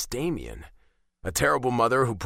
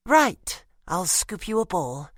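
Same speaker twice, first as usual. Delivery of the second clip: startled out of thought; 8 semitones higher, 3 dB louder, slower than usual. Acted. Woman snapping out of a trance.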